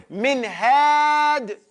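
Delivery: min had said slowly, each word apart; slow clear example. Shown as a demonstration. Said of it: "we say".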